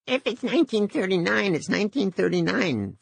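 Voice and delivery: squeaky high voice. A narrator's reading. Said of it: "in a squeaky, high-pitched voice".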